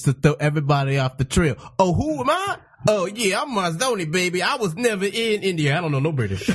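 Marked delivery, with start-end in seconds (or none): 1.8-6.5 lightly hammed-up impersonation of the co-host, higher at first; mock denial. Comic teasing co-host over his surname, in mock denial. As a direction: play the indignant innocent, starting high and settling. Mocks co-host as Marzoni denying.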